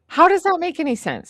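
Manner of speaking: loud, high-pitched disbelief; incredulous. Real, not acted. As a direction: demand an explanation, voice shooting up. Baffled by unrinsed soapy dishes.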